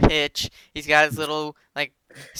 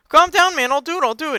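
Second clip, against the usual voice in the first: high, loud caving in; mock panicked yell; 12 semitones higher, 8 dB louder, faster than usual. Vlad giving in to the ranting caller, high and loud. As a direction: shout to make him stop. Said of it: "And he's like".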